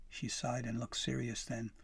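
plain reading voice

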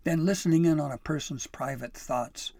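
loud, indignant tirade; heated, emphatic telling-off; raging. A narrator's reading. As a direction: scold hard, leaning on each accusation. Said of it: "she raged".

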